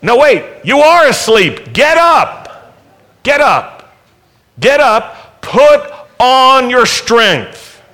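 high, loud commands to rise; urgent command. Acted. Preacher commanding sleepy listeners to get up, high and loud. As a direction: drive them to their feet.

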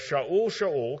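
calling out. Acted. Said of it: "this voice calls out to him in Hebrew".

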